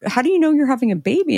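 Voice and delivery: mock-baffled clerk, quick and high; startled question. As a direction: rattle it off like a confused store guy. Store guy's startled reaction retold.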